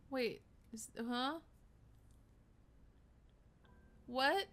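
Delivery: baffled disbelief. Real, not acted. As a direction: react in short, bewildered exclamations. Baffled reactions to the twist.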